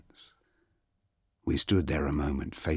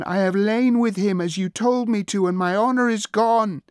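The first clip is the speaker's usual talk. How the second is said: high, loud, raging cry; furious scream; 7 dB louder than usual. Acted. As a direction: scream out your shame. Princess rages, repeats herself.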